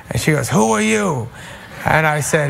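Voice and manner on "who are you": high, mildly frantic question; raised pitch, mock alarm; retold frantic question. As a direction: imitate a confused grandmother in alarm.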